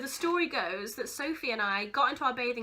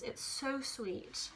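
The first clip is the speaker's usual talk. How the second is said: soft, slow, moved to tears; touched, tearful; 9 dB quieter, much slower than usual. Real, not acted. Reading friends' birthday card years later, softly tearful. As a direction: let it out softly and slowly, welling up. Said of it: "this is bringing tears to my eyes".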